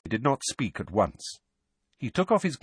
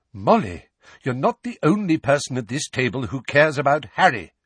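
louder, raised, sharp reproof; sharp rebuke; 6 semitones higher, 6 dB louder than usual. A narrator's reading. Lupin rebukes Molly over who cares about Harry, sharply. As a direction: pull her up sharply. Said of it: "said Lupin sharply".

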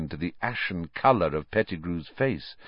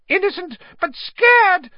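very high squeal rising to loud; Pettigrew squeal; 8 dB louder than usual. A narrator's reading. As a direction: squeal it in fright. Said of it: "squealed Pettigrew".

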